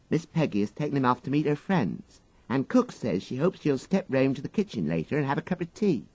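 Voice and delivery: a parlour-maid passing on cook's message. Acted. Parlour-maid reports to Jeeves.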